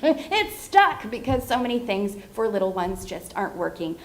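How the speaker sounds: ordinary delivery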